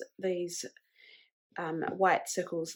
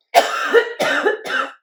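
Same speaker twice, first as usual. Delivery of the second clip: loud harsh coughing fit; real cough; 9 semitones higher, 12 dB louder than usual. Real, not acted. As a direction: cough hard off mic. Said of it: "I'm coughing a lot".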